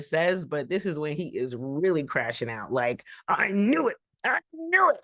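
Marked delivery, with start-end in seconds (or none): 3.3-5.0 mock angry. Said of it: "You know, he's angry".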